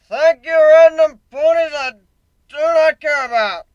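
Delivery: high, loud bellow off the stage; drunken shout. Acted. Bugbear shouting at the crowd after his song, loud. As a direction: yell it at the crowd. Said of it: "the bugbear shouted from the stage".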